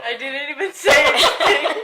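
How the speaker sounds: high, drawn-out protest through laughter; laughing protest. Real, not acted. Daughter denies blame in front of family, laughing protest. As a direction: protest innocence while laughing.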